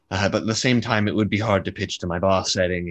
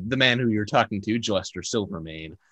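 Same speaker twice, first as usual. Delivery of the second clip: ordinary talk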